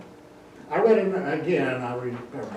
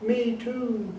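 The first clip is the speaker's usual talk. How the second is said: high, slow hooting female owl; answering owl hoot; 11 semitones higher, 3 dB louder, slower than usual. Pretend. Female owl answering her mate, high hooting. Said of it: "you'll hear back".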